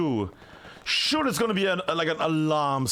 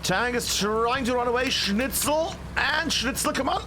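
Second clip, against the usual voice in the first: excited; 5 semitones higher than usual. Real, not acted. Caster urging a player's rocket shot.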